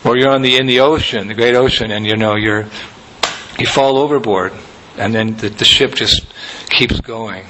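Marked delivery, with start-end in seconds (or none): none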